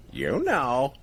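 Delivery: drawn-out, lightly voiced parrot; a hint of knowing parrot; parrot punchline. Host repeating the joke's punchline, light parrot voice.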